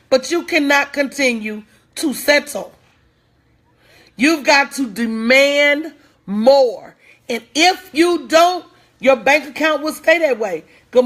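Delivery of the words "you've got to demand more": slow emphatic. Real, not acted.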